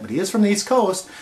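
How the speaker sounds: ordinary talk, nothing marked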